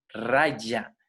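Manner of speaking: "Raya" with a Spanish y in the middle. Shown as a demonstration. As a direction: demonstrate "Raya", the Sanskrit j said as y. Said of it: "se pronuncia como una Y".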